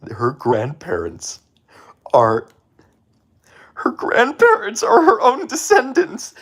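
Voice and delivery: slow, rising to a high loud cry; dramatic reveal. Real, not acted. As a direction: build it into a stunned shout.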